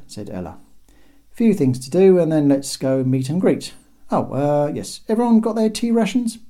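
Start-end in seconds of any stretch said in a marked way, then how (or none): 1.3-6.4 higher, louder cheerful orders; rally the troops briskly; brisk commanding leader. Acted. Ella briefs the crowd.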